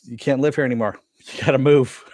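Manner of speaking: blunt order breaking into laughter; laughing while retelling a blunt order. Retold or imitated. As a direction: bark the news, then crack up at how blunt it is. Mando bluntly tells the villagers.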